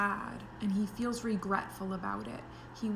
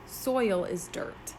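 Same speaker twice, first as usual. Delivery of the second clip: plain reading voice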